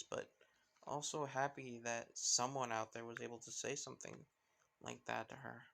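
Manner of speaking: plain reading voice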